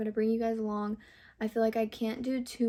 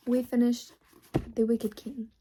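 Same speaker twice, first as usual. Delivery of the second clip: ordinary talk, nothing marked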